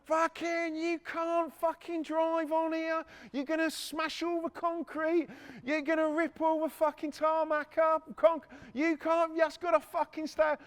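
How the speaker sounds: high, slow mock rant of an angry yard worker; hammed-up angry worker, pitched high; angry bloke yelling. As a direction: play the furious site bloke shouting at the driver. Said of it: "This bloke's coming out".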